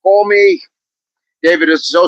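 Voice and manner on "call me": put-on Boston accent. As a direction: sing it out Boston style. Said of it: "was [name] from Boston?".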